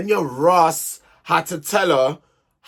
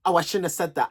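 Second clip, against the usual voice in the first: usual speaking voice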